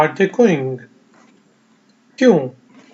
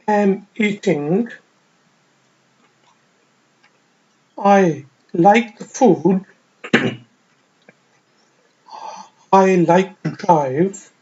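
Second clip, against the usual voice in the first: slow, careful teacher reading; slow model sentences; much slower than usual. Shown as a demonstration. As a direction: lay out each sentence slowly for students to repeat. Reads model sentences for learners. (धीमी, साफ़ उस्ताद वाली पढ़ाई)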